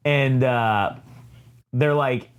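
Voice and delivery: normal talking voice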